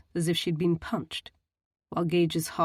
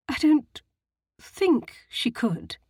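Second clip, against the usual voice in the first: hesitant correction; 7 semitones higher, 4 dB louder, slower than usual. A narrator's reading. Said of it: "she amended".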